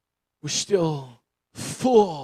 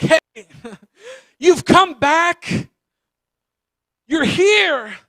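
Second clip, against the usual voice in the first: high, loud, laughing welcome; overjoyed greeting; 9 semitones higher, 7 dB louder, much slower than usual. Acted. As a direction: burst out laughing with joy at the sight of them. Parents greet returning child.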